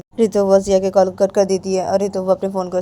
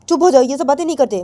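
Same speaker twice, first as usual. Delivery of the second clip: loud, higher-pitched telling-off; scolding; 6 semitones higher, 6 dB louder than usual. Acted. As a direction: silence them with a raised voice. Acted scolding, telling to be quiet. (ऊँची आवाज़ में डाँटकर)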